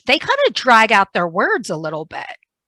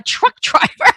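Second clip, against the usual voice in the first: high-pitched laugh breaking into the words; 13 semitones higher than usual. Real, not acted. Speaker joking about their own cursing, laughing. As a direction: crack up mid-phrase.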